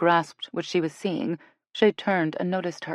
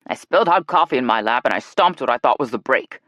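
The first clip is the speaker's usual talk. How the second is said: louder, irritated spit-out confession; grumpy, defensive outburst; spat out; 3 semitones lower, 6 dB louder than usual. Acted. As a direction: get it out fast and annoyed. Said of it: "Dale spat out".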